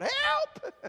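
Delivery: high laughing yelp of Help; crying out. Retold or imitated. Preacher retelling his own cry in hard times, high yelp. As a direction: squeal it out, half laughing. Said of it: "cry out".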